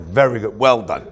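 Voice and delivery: imitating a dad's low, satisfied approval; a hint of a clueless dad praising; approving father. Mimics his unwitting father's approval.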